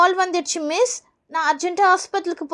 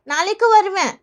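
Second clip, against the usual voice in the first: normal talking voice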